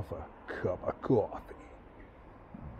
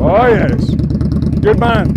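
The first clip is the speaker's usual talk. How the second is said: loud, raised-pitch approval; delighted cheering; 5 semitones higher, 12 dB louder than usual. Real, not acted. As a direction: cheer the rider on over the engine.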